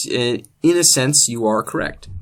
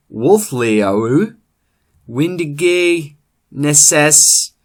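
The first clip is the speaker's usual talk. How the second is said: loud, slow, booming epic recitation; slow thundering saga in Old English; dramatic Old English reading; 5 dB louder, much slower than usual. Acted. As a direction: declaim it like an ancient bard. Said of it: "I present to you now a historical, uh, reenactment of Beowulf".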